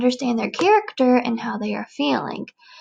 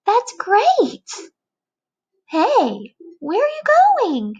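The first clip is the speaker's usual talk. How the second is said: high, startled calling out; surprised butterfly; 10 semitones higher, 4 dB louder, slower than usual. Acted. Butterfly calling to the friend who is flying off, high and startled. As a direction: call after a leaving friend in alarm. Butterfly voiced in read story.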